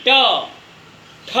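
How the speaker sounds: ट loud and crisp, then ठ, the tongue flicked down from the roof; jerked retroflex sounds. Shown as a demonstration. Teacher showing students the tongue jerk in ट and ठ, crisp retroflex. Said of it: "एक झटका मार के नीचे आती है".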